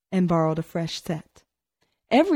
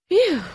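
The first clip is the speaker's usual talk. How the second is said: much higher, louder relieved "Phew!"; 14 semitones higher, 6 dB louder than usual. A narrator's reading. Tony alone after comforting his sister, loud relieved phew. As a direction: blow out the relief.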